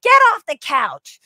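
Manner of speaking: high, loud, slow scold; old lady yelling. Retold or imitated. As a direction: bark it like a stern old lady. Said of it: "the old lady being like".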